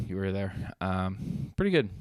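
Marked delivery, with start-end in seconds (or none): 1.6-1.9 "pretty good" lifted high in a sing-song; light high "pretty good"; pitched-up pretty good. Real, not acted. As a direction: toss off "pretty good" in a high teasing lilt. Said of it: "repeated verdict".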